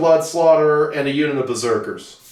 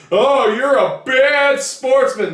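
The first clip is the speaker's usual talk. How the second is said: mock whiny gamer; 8 semitones higher, slower than usual. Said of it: "hurt some little pussy's feelings".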